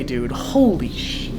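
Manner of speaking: ordinary delivery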